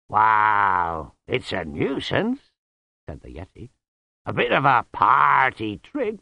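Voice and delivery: loud, slow, booming Yeti; a big friendly beast shrugging it off; slow, loud, easygoing Yeti voice; good-natured Yeti. Acted. Yeti character speaking.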